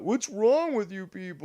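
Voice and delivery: high, put-on voice reading the chat; mock a baffled viewer; imitating Travis. Streamer reading Travis's chat message in a high mock voice. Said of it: "look at Travis in the chat".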